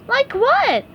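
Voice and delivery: high curious sponge voice; eager question. A narrator's reading. SpongeBob quizzing Patrick, high curious voice. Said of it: "SpongeBob asked".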